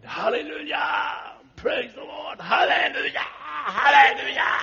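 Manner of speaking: high, slow, loud crying-out praise; shouting hallelujah. Retold or imitated. Father reunited with daughter in supermarket, shouting praise through tears. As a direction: cry out to the heavens with tearful joy. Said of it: "he started shouting out".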